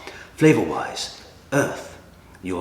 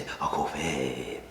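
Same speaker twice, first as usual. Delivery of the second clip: drawn-out put-on regional accent on COFFEE; 2 semitones lower, 5 dB quieter, much slower than usual. Shown as a demonstration. Reviewer demonstrating how to say coffee, mock regional accent. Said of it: "say it in a regional accent".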